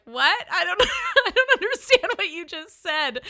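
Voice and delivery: high, loud, laughing through the words; can't get the words out for laughing; laughing disbelief. Retold or imitated. To the Southern family, loud laughing confusion.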